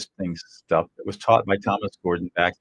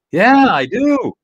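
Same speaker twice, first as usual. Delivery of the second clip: high, slow voice of the soothed partner; lightly acted partner agreeing; partner role; 12 semitones higher, 6 dB louder, much slower than usual. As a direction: give in, drawn out and high.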